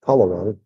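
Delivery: Palawan said PAH-la-wahn; wrong take, PAH-la-wahn for Palawan; mispronounced Palawan. Shown as a demonstration. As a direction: stress the first syllable of Palawan, with an ah. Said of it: "Or they say".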